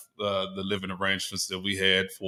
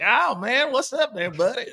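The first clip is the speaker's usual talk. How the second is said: high, hammed-up country twang; mock country accent; 13 semitones higher than usual. Guest mocks what country sounds like with a high, hammed-up country twang. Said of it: "Country to me sounds like".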